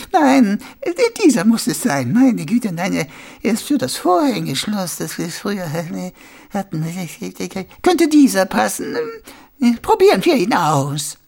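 put-on high, hesitant little old woman; dithering old lady. Old shopkeeper hunting for the right key, dithering.

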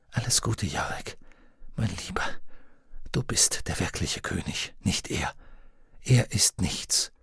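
soft, low and tender; hushed, gentle goodbye; tender farewell. Acted. Lyra's tender farewell to Yorick.

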